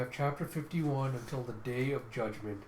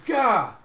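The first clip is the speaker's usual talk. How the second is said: loud, high comic bawl; sudden hammed-up wail; mock crying wail; 9 semitones higher, 13 dB louder than usual. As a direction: blurt out an exaggerated cry. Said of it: "that's just him crying".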